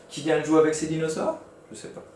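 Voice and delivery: a lightly voiced puzzled character asking; puzzled Monsieur Interro. Shown as a demonstration. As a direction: sound like someone who doesn't know. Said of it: "Monsieur Interro". (Monsieur Interro perplexe, voix légèrement jouée)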